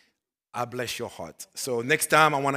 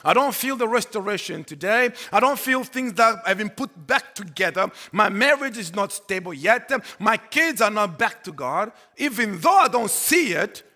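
loud, raised declaration of faith; preaching; 9 semitones higher, 10 dB louder than usual. Acted. Pastor voicing belief despite no healing yet, preaching. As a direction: proclaim each phrase high and forcefully.

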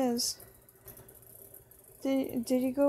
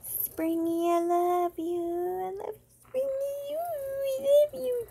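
mock adoring; 7 semitones higher than usual. Player pretends to like Springy, mock-adoring coo. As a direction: coo it, climbing higher and higher. Sweet-talking Springy to appease him.

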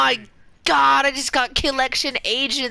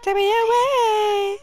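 mock dramatic plea; 10 semitones higher, slower than usual.

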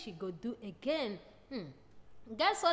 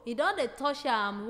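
normal talking voice

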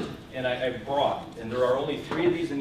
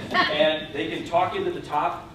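ordinary talk, nothing marked

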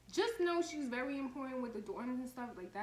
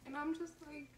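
normal talking voice

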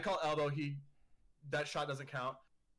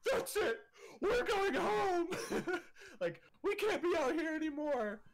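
much higher, louder, hammed-up flustered mother; mock frantic mom; 4 dB louder than usual. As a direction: squeal it like a mom calling time. Becca's mom voiced ending the day.

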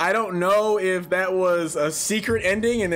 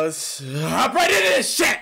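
hyped launch; 4 semitones higher than usual. Real, not acted. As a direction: kick it off with a raised, pumped-up shout. Host launches the next game.

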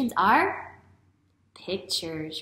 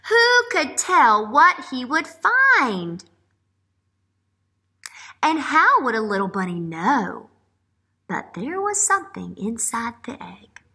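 put-on reading to children; 2 semitones higher, 9 dB louder than usual. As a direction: read it aloud to wide-eyed kids, slowing on each guess. Reads picture book aloud.

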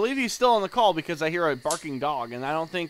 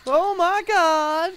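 mock surprise; 13 semitones higher, 6 dB louder, much slower than usual. Mock echo of a gasp.

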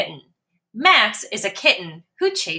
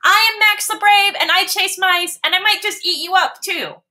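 boastful kitten; 11 semitones higher than usual. Acted. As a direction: brag to scare the mouse. Max boasts loudly.

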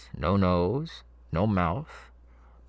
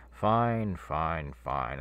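usual speaking voice

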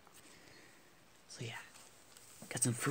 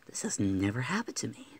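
usual speaking voice

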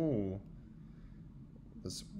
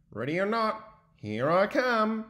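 calling out seeker; 8 semitones higher, 5 dB louder, slower than usual. Acted. Beaver calls out in game.

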